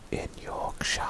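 breathy hush to close the read; whisper. Pretend. Reading the label, he whispers the last words. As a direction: whisper it like a secret.

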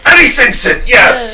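high, loud triumphant call; excited commentator shout. Pretend. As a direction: burst out as the putt drops. Golf commentator climax.

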